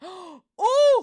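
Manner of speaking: sharp delighted gasp; excited gasp. Real, not acted. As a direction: gasp loudly at a lucky number. Spots a number on her card.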